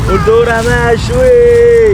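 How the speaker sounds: slow prompting. Shown as a demonstration. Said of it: "يلا قول".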